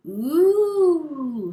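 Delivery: high, loud ooh of wonder; children gaping at the sights; awed ooh. Acted. Little crocodiles see Egypt, loud high ooh. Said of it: "said all the little crocodiles".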